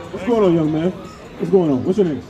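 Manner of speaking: usual speaking voice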